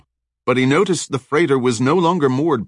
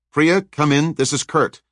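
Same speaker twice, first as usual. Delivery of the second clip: Kurt calling over the radio, raised and urgent; 6 semitones higher than usual. Acted. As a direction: call out for your missing friend. Radio call.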